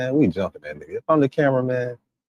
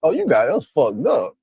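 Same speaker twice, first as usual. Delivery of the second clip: higher, defiant warning; a higher, defiant mock warning; mock indignant threat; 6 semitones higher, 3 dB louder than usual. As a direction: stand up for your dog. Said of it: "You know what my response is".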